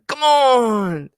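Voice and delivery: put-on high excited cry of "Come on!" in an Argentine accent, falling at the end; Fernando's catchphrase. Guide voicing Fernando on the jump slide, high excited cry. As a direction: whoop it up for the jump. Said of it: "Same thing".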